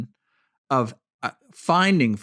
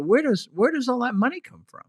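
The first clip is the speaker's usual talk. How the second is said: incredulous; 10 semitones higher than usual. Real, not acted. Incredulous question about money.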